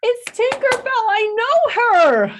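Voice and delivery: squealed high and loud, drawn out slow; giddy excitement. Retold or imitated. Mom spotting Tinkerbell on a float, high giddy squeal. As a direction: starstruck fan squeal, very high. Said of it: "I was like".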